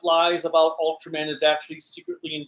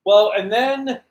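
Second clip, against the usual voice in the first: normal talking voice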